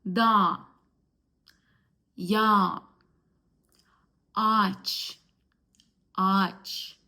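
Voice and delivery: da and ya with a long open a, no g; aç with a lengthened a, each word alone; silent soft g. Shown as a demonstration. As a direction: hold the vowel where the soft g is silent. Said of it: "letter we don't pronounce".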